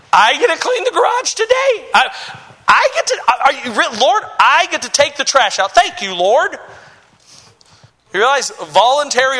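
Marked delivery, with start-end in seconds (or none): 0.1-6.6 acted giddy excitement, high-pitched at first; amazed delight. Eager believer thanking the Lord for chores, mock overjoyed. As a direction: gush in thrilled disbelief, hammed up. Acts out delight at serving God.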